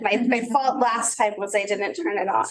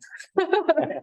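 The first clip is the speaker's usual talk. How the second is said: nervous laugh; 7 semitones higher than usual. Real, not acted. Embarrassed laugh after nervous remark.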